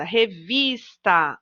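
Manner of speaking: revista slowly, with VIS drawn out; stress on VIS. Shown as a demonstration. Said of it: "Qual é a sílaba que eu falo com mais força?".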